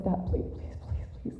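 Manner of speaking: pleading in a hushed whisper; frightened whisper. Retold or imitated. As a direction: beg softly so Dad won't hear. Mom pleading behind the door.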